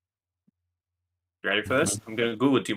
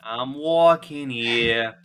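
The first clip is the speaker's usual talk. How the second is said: slow New York drawl; much slower than usual. Pretend. Echoing the catchphrase slower.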